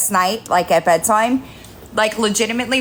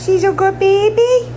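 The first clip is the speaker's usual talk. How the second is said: high, louder cooing to the kitten; 9 semitones higher, 4 dB louder than usual. Real, not acted. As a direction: coo it up high to the cat. Repeated in baby talk.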